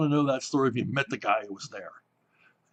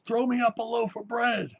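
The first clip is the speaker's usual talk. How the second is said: high-pitched call, stretched out; retold yell; 9 semitones higher than usual. Convict two stories up to a bread driver below, imitated yell. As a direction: holler it high through prison bars, without real volume. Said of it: "he's yelling out through the bars".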